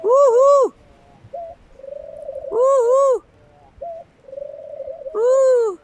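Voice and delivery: high, loud whooping cheers; joyful whoops. Real, not acted. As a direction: whoop for joy at the top of your voice. Cheering the bird home.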